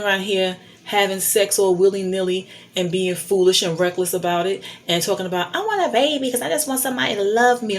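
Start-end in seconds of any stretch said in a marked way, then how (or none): 5.5-7.8 lightly mocked teen, quick and higher; mocking teenager. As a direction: imitate a needy teenager, rushed. Mocks teenagers wanting babies.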